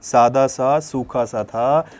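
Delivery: slowed down, each word spaced out for the class; a slow, deliberate teacher repeat; slow drawn-out repeat. Shown as a demonstration. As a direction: say it slowly so it sticks. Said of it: "कैसा था sir?".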